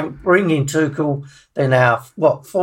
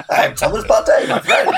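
imitating Partey; 5 semitones higher than usual. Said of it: "That flourish, my friend".

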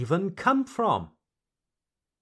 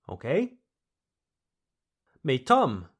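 usual speaking voice

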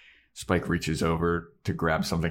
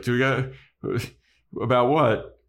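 higher, louder questioning retort; questioning Buffy; 6 semitones higher, 3 dB louder than usual. Acted. Buffy answering Spike's request to talk, high and questioning. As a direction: act out a puzzled comeback. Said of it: "And Buffy's like".

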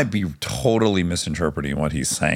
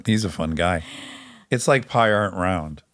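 usual speaking voice

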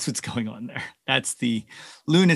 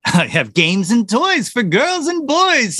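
higher, bouncing cheerful recitation; cheery Santa; 9 semitones higher, 6 dB louder, slower than usual. A narrator's reading. Santa offers toys to the reindeer, cheerily. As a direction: offer it with lilting good cheer. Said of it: "said Santa cheerily".

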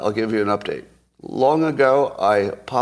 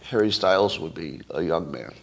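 normal talking voice

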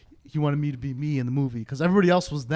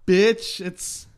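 retold taunt, higher and louder; mock gotcha; 7 semitones higher, 7 dB louder, slower than usual. Telling friend about the Uber twist, mock gotcha. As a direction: crow the punchline, pitched up.

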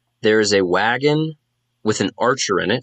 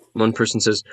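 normal talking voice

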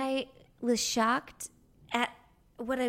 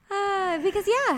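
laughing; 12 semitones higher than usual. Real, not acted. Laughing hard, caught out.

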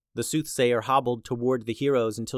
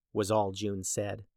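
usual speaking voice

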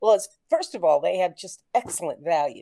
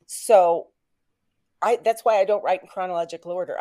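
normal talking voice

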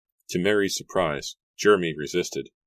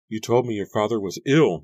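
higher, louder accusation; angry cry; 5 semitones higher, 6 dB louder than usual. A narrator's reading. Mary confronts Jeremy about his father, angry cry. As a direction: throw it at him angrily. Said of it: "Mary cried angrily".

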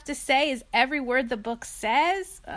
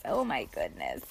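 much lower, flat reading; deep deadpan; 8 dB quieter than usual. Acted. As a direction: drop the voice deep and dry. Reading line deadpan low.

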